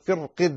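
"فرق" with a thin, light ra; thin the ر after the kasra in "فرق". Shown as a demonstration. Teacher giving some scholars' reading, "فرق" with light ra. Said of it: "ترقق نظرا للكسرة".

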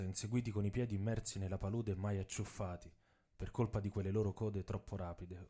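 normal talking voice

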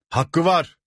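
high, sharp call of support; shouted agreement. A narrator's reading. Villager backs the steward's request, shouting. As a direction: call it out from the crowd. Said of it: "diye bağırdı bir köylü".

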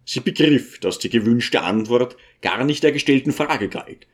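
plain reading voice

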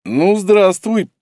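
slow, put-on groan under weight; mock grunting. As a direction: grunt it out theatrically. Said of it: "покряхтел я напоказ".

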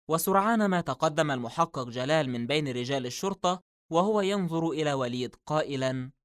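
plain reading voice

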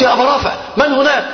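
high, altered voice pretending to help; disguised voice. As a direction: call out as if a rescuer. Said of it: "فغيرت صوتي فقلت".

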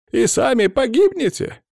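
very high, distressed outcry; panicked warning. A narrator's reading. Cursed man warning his rescuers, panicked warning. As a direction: wail a warning of doom.

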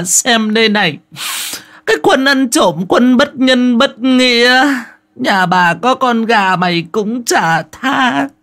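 high, loud village scolding; shrill cursing old woman. Acted. Old Bà Bảy screaming curses at the chicken thief. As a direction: screech curses at the whole village. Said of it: "ấy là cái tiếng chửi chua ngoa của bà ấy".

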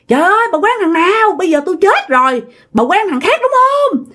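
high-pitched scolding, stretched accusing questions; retold angry yelling. Possessed neighbour yells at the woman she accuses, high mock scolding. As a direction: act out a neighbour hollering accusations. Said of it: "bả la quá trời la luôn".

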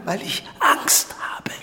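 whispered admission of fear; hushed, shamed confession; fearful whisper. Acted. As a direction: barely voice the fear.

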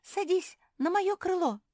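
very high, loud bird voice; piercing little-bird invitation; chirping bird. A narrator's reading. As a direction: chirp at full power. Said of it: "во всю мощь зачирикала Ляля".

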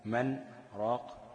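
very slow, brief stop on the نون of من before راق; stop after من. Shown as a demonstration. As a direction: halt on من without breath, then راق. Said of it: "وعلى نون". (سكتة على نون من قبل راق)

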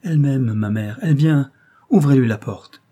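loud, ringing command. Acted. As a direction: burst out with the news. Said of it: "reprit Mohsen d'une voix éclatante".

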